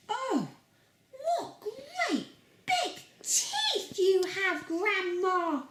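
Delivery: put-on high, slow and giggly girl; giggly little girl in awe. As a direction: wonder at it like a tiny girl, giggling. Girl voiced in the story.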